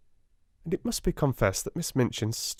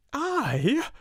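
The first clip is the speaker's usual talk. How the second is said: high panted "I?"; panting. Acted. Amelia, ordered by her sister, panting. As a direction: gasp it out, shocked. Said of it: "panted Miss Amelia".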